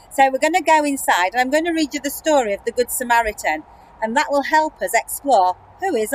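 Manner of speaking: eager outdoor announcement; projected outdoor voice. Shown as a demonstration. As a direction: project it brightly outdoors, quickly.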